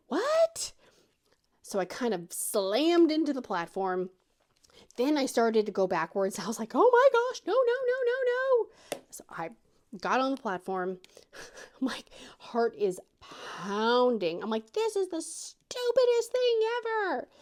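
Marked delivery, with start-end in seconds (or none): 0.1-0.7 very high startled squawk; panicked confusion. Retold or imitated. Mom on zip line yelling back to husband, panicked. Can't follow yelled instructions mid-ride. 6.7-9.0 high, loud panicky cry; panicked. Retold or imitated. Mom sliding backwards off the platform, panicked. 14.7-17.3 very high strained squeal; panicked outburst. Retold or imitated. Mom with pounding heart after landing, squealing in protest.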